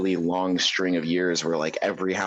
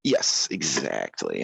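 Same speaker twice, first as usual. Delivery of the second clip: put-on old southern gentleman; 8 semitones lower, much slower than usual. Stays in southern voice.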